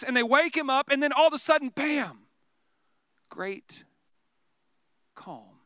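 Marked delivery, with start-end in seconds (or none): 3.3-5.6 fading to a whisper; whispered peace after the storm; hushed calm. Shown as a demonstration. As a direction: let the voice die away into stillness. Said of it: "describing storm stopping".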